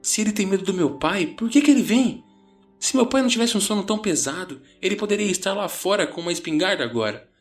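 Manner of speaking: high, rushed, exasperated questioning; agitated friend demanding an answer. A narrator's reading. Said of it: "Johnny disse exasperado".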